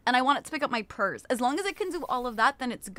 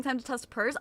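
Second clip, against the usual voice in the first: normal talking voice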